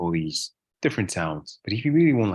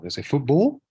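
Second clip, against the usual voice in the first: high, loud Scouse lilt rising on football; Scouse accent; 9 semitones higher, 5 dB louder than usual. Shown as a demonstration. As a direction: put on a Liverpool Scouse accent. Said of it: "the Scouse accent".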